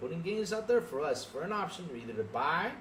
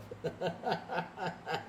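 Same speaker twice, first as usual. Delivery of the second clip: scoffing laughter; 4 dB quieter than usual. Real, not acted. Streamer brushing off Adam's jab, scoffing laughter. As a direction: run of repeated laughs.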